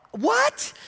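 squeaky high astonished what; shocked what. Retold or imitated. Husband reacting to the dream, squeaky shocked. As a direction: shoot way up in disbelief. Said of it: "And I'm like".

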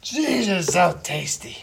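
excited. Real, not acted. Raving over a sandwich bite.

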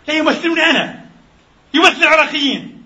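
high, biting sarcastic retort. Real, not acted. Preacher mocks the columnists' question with sarcasm.

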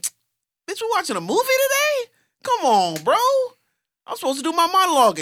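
high, loud, fast indignant protest; mock outraged teen. Teen [name] complaining to his crying teacher, high and indignant. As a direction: complain to the teacher like a cocky kid. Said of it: "and I was like".

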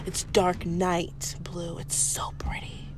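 slow whispered admiration; gushing whisper. Retold or imitated. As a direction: breathe it out in awe, whispering. Customer gushing about hair.

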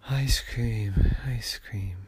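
whispered, breathy dreamy murmur; sleepy whisper between snores; sleep-talking. Acted. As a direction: mumble it in your sleep, fading.

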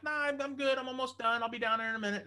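very high, airy-innocent call back; feigned casual. Retold or imitated. Guest hides the repair from wife, feigned casual. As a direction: pitch way up, covering panic with cheer. Calls back, hiding his panic.

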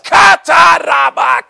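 high, slow, full-throated tongues cry; wild shouted tongues, held out long; mock prayer scream. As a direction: belt it like praying at the top of your lungs. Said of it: "screaming at the top of my lungs".

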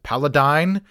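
"Paladine" ending like "dine"; PAL-a-DINE, long i at the end; variant pronunciation. Shown as a demonstration. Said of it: "they've pronounced it".

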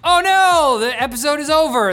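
high, loud cry of dismay; mock alarm. Host discovering the episode has ended, loud mock alarm. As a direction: cry out as if disaster struck, then ease off.

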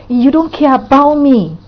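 guilt-trip a friend with a high, loud protest; accusing guilt-trip. Acted. Friend emotionally blackmailing another, accusing.

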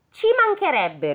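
high, scornful retort; mock-haughty sneer of a rude girl; rude peasant girl. A narrator's reading. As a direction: toss it off like a spoiled brat. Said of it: "rispose quella villana".

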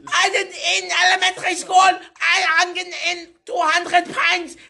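high, shouted mock German rant; angry German accent. Angry German berating Joseph, shouted German accent. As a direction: shriek orders in a caricatured German accent. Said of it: "the English one".

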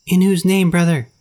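high bird voice calling; calling kite. A narrator's reading. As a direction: call out like a bird. Kite calling back to Mowgli.

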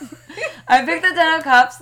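laughing, high and loud; excited laughing. Real, not acted. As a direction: burst out with delighted disbelief. Excited tarot pull.

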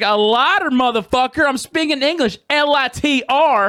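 high, indignant retelling; exasperated retort. Retold or imitated. Retells exasperation at being misunderstood.

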